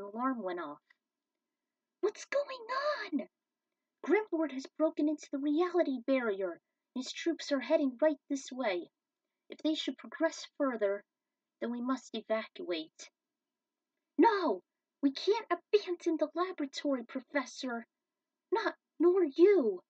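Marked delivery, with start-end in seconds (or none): 2.0-3.3 high breathy whispered alarm; startled whisper. Acted. Hero hears the alarm, high startled whisper. 4.0-13.1 louder, higher urgent professor; urgent warning. Acted. Professor warns the team of invading troops, urgent. 14.2-19.8 loud, high cry of refusal; desperate protest. Acted. Caitlin begs the professor not to abandon the lab, shouting.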